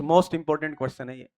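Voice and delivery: most drawn out slowly for emphasis; slow, weighty emphasis on most important; stress on most. Shown as a demonstration. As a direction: lean hard on most, most important.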